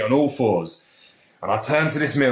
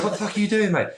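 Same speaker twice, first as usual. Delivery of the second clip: incredulous at himself; 11 semitones higher, faster than usual. Retold or imitated. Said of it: "I literally said".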